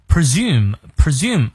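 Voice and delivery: presume said slowly, the s voiced like z; careful presume. Shown as a demonstration. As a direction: stretch presume, with a z sound in the middle. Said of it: "注意后面S发字的音".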